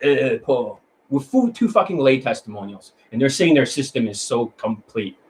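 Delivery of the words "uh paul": lightly voiced mock of a rival coach, name-dropping; a hint of a smug coach stammering a name; mocking other coaches. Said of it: "other coaches that said".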